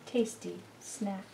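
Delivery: ordinary delivery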